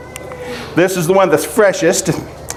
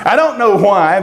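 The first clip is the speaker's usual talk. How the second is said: ordinary talk, nothing marked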